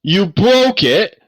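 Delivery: high, loud, drawn-out accusation; upset accusation. Acted. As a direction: wail it at the culprit. Smith accuses player of breaking sword.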